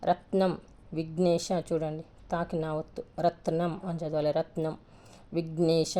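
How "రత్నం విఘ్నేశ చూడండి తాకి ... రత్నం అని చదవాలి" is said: ordinary talk, nothing marked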